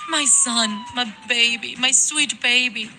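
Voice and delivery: high-pitched cries of grief; anguished mother calling for her baby; grief-stricken lament. Acted. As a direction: mourn your child aloud. Mother mourns her dying son.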